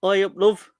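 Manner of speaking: cheery mock Yorkshire, playing up the accent. Asked by a Yorkshire friend to show his Yorkshire accent. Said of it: "give us your Yorkshire accent".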